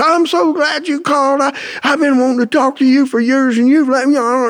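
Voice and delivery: high, relieved mother voice; mother's voice. Retold or imitated. Student quotes his crying mother.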